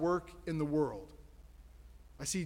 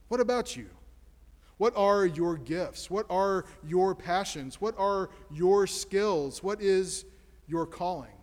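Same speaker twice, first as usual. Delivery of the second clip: slow, deliberate questions to each listener; asking the congregation one by one; slow probing questions; 3 dB louder than usual. Real, not acted. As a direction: let each question land slowly.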